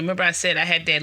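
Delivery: ordinary talk, nothing marked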